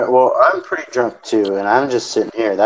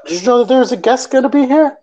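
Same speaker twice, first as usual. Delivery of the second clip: high-pitched incredulous question; taken aback; 11 semitones higher than usual. Real, not acted. Host asking John about an unexpected guest, taken aback. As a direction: ask in high-pitched surprise.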